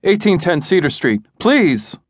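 high, panicked plea over heavy breathing; a frantic caller gasping out the address; husband on the phone to 911, breathless and panicked. A narrator's reading. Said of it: "Breathing heavy".

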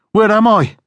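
frightened. Acted. Waking in strange dark place.

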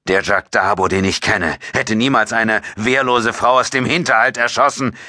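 restrained anger, pitch climbing towards the end; through gritted teeth. A narrator's reading. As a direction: force the accusation out through clenched teeth. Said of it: "stieß Ryan zwischen zusammengebissenen Zähnen hervor".

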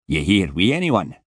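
put-on heavy Scottish accent, impatient and put out; a surly Scottish security man scolding; Scottish guard telling a boy to get up; grumpy Scottish guard. Scottish guard voiced in story.

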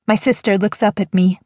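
ordinary delivery

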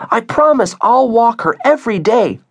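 put-on high, loud child's promise; earnest pleading child. Via promising her mom to walk the dog, earnest pleading. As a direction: vow it eagerly in a high voice.

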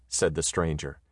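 plain reading voice

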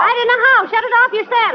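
panicked. Acted. Molly refusing McGee while records fly, panicked high protest. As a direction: push it back at him in a panic.

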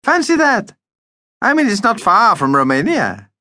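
high, delighted exclamation; excited. A narrator's reading. Vampire tells Mum they are near neighbours, excited. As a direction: marvel excitedly in a high character voice. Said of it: "he told Mum excitedly".

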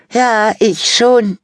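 put-on high, loud, slow smug girl; vain Fabienne. A narrator's reading. Vain sister praising herself, high, loud and slow. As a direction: preen over yourself. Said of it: "sagte Fabienne".

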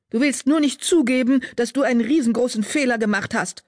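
high, heated spitting anger; angry. A narrator's reading. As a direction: lash out, insulted and furious. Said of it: "Wind fauchte".

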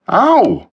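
high, loud yelp of pain. A narrator's reading. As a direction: cry out when the pistol jabs. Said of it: "yelled Martin".

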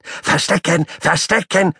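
high-pitched excited little animal; shrill repeated plea; squeaky child call. Acted. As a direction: chant it like a pestering puppy.

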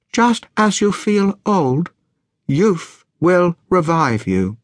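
slow, portentous reading in separate words; fortune cookie reading. A narrator's reading. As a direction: read it out word by careful word.

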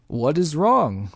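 put-on German accent growl. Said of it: "he growled in a thick German accent".

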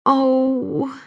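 quiet, sad little groan; soft groan. A narrator's reading. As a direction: sigh it out softly. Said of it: "she groaned softly".

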